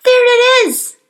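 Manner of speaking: high, loud startled cry. Acted. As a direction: shout it out in alarm. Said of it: "cried the brother".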